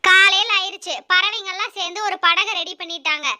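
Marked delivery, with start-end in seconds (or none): none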